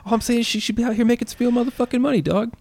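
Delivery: put-on high, fast squeaky kid; squeaky South Park kid arguing; cartoon kid voice. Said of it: "When Butters is sitting in class".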